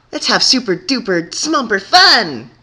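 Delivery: high, loud, slow squeal; giddy. A narrator's reading. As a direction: squeal it with glee. Pinkie's giddy party cheer.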